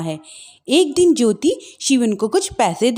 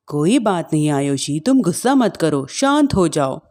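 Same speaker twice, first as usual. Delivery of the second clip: lower, soothing husband; calm her down gently; 8 semitones lower than usual. Acted.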